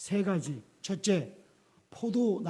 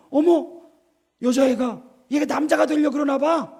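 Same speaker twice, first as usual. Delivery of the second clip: mock shocked gasp; 5 semitones higher, 6 dB louder than usual. Mocking adults in America.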